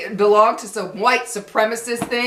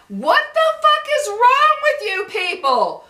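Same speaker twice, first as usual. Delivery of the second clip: much higher, exasperated yell; furious outburst; 12 semitones higher than usual. Real, not acted. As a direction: let the anger burst out high and raw. Angry rant about bigotry.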